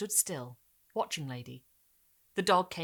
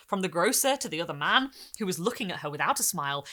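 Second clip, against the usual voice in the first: ordinary talk, nothing marked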